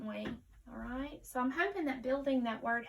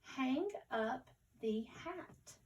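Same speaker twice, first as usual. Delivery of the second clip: slow but connected, the words joined in one sentence; more fluent reading; 2 semitones higher, slower than usual. Shown as a demonstration. Teacher rereading the sentence with pupils, more fluently. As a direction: read it smoothly at a beginner's slow pace. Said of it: "let's read it again more fluently".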